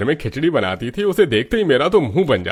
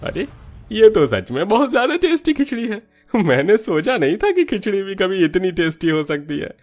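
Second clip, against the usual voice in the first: pitched up high with delighted surprise; amazed delight; 7 semitones higher than usual. Acted. Customer tastes the khichdi and is amazed, high delighted exclaim. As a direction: react as if the first bite stunned you.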